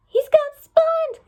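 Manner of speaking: high, loud, drawn-out alarmed scream. Acted. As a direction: shriek that Spot has been taken. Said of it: "Catbig screamed".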